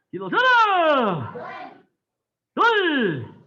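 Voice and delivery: high, loud calls with long falling tails; shouted drill commands. Real, not acted. Instructor drilling the kicking class, drawn-out shouts. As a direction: bellow each command, stretching the last vowel.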